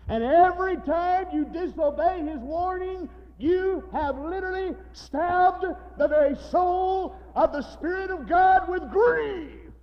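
very high, loud, drawn-out pulpit cry; full-force preaching. Real, not acted. Preacher warning the saved who disobey, shouted preaching.

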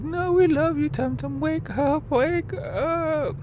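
high squeaky wake up plea, hint of crying; crying friends begging the rider to wake up. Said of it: "they're, like, crying".

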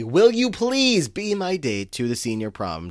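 hammed-up slow, high holler; imitated loud scream. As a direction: belt out the promposal slowly, pitched high. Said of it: "He screams very loudly".

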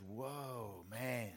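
low, hushed amazement; soft, low mock wonder; acted awe. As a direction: drop your voice as if stunned by what you see. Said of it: "Adam goes".

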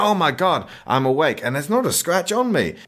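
imitating someone stunned to be alive; mock amazement. Tom waking unscathed in the mortuary, mock amazement.

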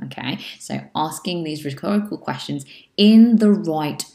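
usual speaking voice

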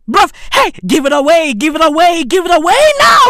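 from a pushy plea up to a loud high yell; building shout. Real, not acted. Ranting at the heiress, building into a shout. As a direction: hammer it harder each time.

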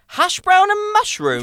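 high, slow disbelieving repeat; amazed at the filling; incredulous echo. Real, not acted. Host repeats the sandwich filling in amazement, high and slow.

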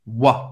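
"wa" curto, como O A; wa sound. Shown as a demonstration. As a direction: mostre o som "wa". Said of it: "O I tem o som do wa". (som "wa" isolado)

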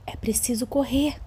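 breathy suspense whisper. Acted. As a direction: whisper it like a secret. Suspense aside in story.

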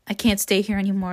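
ordinary delivery

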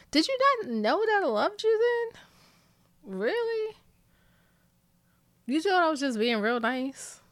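high, mock-amazed questioning; teasing disbelief. Real, not acted. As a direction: lift it in playful disbelief. Teasing boyfriend on camera.

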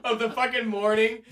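ordinary delivery

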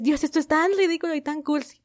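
high-pitched, imitating a giddy embarrassed squeal; mock cringe squeal. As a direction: squeal at something too cheesy. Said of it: "te dan ganas de gritar porque dices".

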